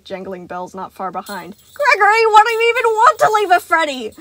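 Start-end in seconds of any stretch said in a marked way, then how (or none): 1.7-4.1 very high, loud pleading question; calling after. A narrator's reading. Sun calling after fleeing Gregory. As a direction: call after him in pursuit. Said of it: "Sun called after him".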